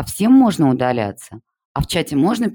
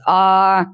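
short high loud scream; 7 semitones higher, 5 dB louder than usual. A narrator's reading. A pupil showing off to the teacher, high loud scream. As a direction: belt out one sharp high yell. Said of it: "закричала Анечка".

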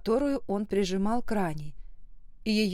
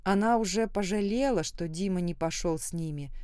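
plain reading voice

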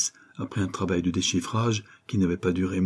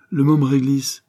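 higher, louder, proud street urchin; louder, high-pitched gamin boast; cocky street kid; 6 semitones higher, 7 dB louder than usual. Acted. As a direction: announce the nickname like a boast. Street kid announces his nickname.

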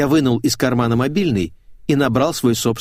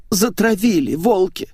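high-pitched, tearful accusation; sobbing complaint; 11 semitones higher, 3 dB louder than usual. A narrator's reading. Светик accusing her colleagues of hounding her, sobbing. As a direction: wail it like a persecuted victim. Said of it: "всхлипнула Светик".

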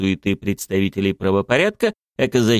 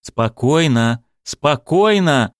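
slow, drawn-out calming command, rising higher; trying to take control; 6 semitones higher, 4 dB louder, much slower than usual. Acted. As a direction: settle the room with stretched words. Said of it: "попытался взять ситуацию под контроль". (протяжно успокаивая всех)

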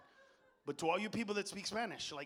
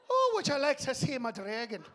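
loud, higher mock Irish accent; 5 semitones higher, 6 dB louder than usual. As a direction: put on a cheeky Irish lilt. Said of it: "if the fucker was Irish".

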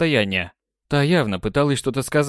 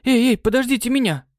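indignant cry; 12 semitones higher than usual. Acted. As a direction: protest at being left behind. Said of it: "возмутилась Май".

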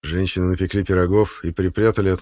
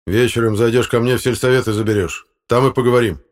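tag; 4 semitones higher, 6 dB louder than usual. Acted. Chairman orders after outburst.

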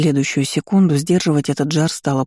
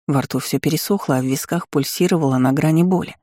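plain reading voice

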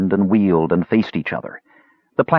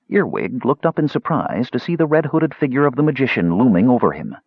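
usual speaking voice

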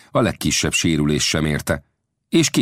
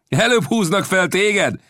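gleeful captain's taunt, pitched up; taunting call back; 12 semitones higher than usual. A narrator's reading. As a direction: jeer back across the water.

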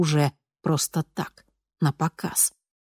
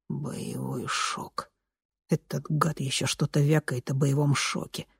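bitter murmur; 3 semitones lower, 8 dB quieter than usual. A narrator's reading. He repeats the phrase bitterly.

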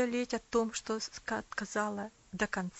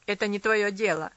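angry snap; 9 dB louder than usual. A narrator's reading. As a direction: spit it out angrily. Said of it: "зло процедил он".

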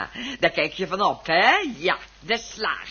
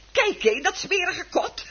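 spottend lachend; 9 semitones higher than usual. Acted. Vrouw spot met voorstel schuur.

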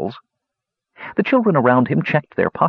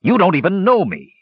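higher, irritated growl; 7 semitones higher than usual. A narrator's reading. Captive warrior rejecting the old woman, growling. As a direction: snarl it at a pestering captor. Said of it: "growled Gorath".

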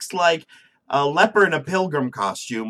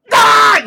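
huge high yelled war cry; dwarf battle cry; 13 dB louder than usual. Retold or imitated. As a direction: charge in screaming like a dwarf. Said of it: "the dwarves show up and just be like".

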